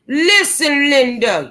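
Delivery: high, drawn-out "Listen"; mock scolding catchphrase. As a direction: call someone out in exaggerated exasperation. Repeats catchphrase from mock rant.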